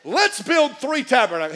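loud, high, eager Peter bursting in; eager loud blurt. Acted. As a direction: blurt it out, too excited to wait.

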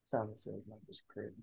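hushed whisper to the speaker; whisper yelling. Real, not acted. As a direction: whisper it so she can't hear. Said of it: "Why you whispering?".

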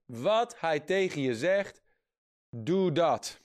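"Wat hij tegen je zegt" slowly, then a firm falling "doe dat"; slow, weighty stress. Real, not acted. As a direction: lay it down slowly, landing on "doe". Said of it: "dat is de sleutel voor wonderen". (langzaam en met nadruk op "doe dat")

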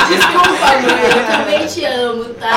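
loud, slow, laughing through it; laughing apology. Real, not acted. Apologizing to her mother on camera, laughing. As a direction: apologize while still cracking up.

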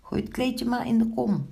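high-pitched helpful call. A narrator's reading. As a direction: call it high, ready to help. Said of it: "riep Goudhuidje". (hoog roepend)

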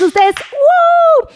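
high, drawn-out whoop; a real delighted whoop; excited cheer. Real, not acted. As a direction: cheer them on like a winner. Cheering the winners.

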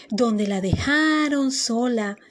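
ordinary delivery

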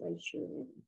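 a quiet, resigned confession; a retold near-murmur agreeing with the stranger; hushed admission. Confessing she feels the abyss.

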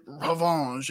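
"Revenge" said mock-French as "ruh-VAHNZH"; mock French "Revenge" with a nasal "vahnzh"; fake French revenge. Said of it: "she's like".

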